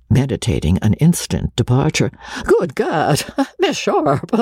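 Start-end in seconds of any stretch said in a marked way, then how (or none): 2.4-4.4 high, tittering exclamation; exclaim, breaking into nervous high giggles; flustered laughter. A narrator's reading. Shy man meditating departure, flustered and laughing.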